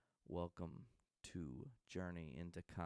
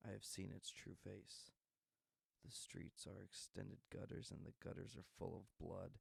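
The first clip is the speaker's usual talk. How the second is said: grim journal reading; 4 dB quieter than usual. Acted. Host opens his show reading a vigilante's grim journal, quiet and brooding. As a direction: read it like a vigilante's diary.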